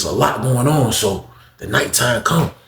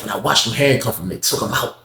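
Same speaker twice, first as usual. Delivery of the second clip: normal talking voice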